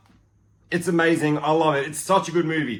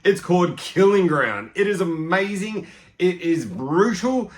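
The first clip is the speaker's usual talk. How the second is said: usual speaking voice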